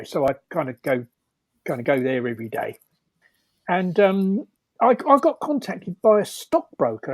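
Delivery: usual speaking voice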